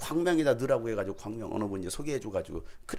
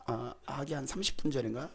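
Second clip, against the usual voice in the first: usual speaking voice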